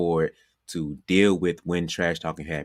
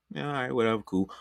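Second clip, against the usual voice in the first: higher, softly tossed-off acted reply; dismissive brush-off; 6 semitones higher, 4 dB quieter than usual. Player answering Larry Bird's trash talk, mildly dismissive. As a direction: shrug it off without engaging.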